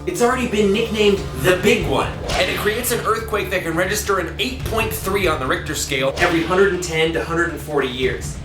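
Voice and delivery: ordinary delivery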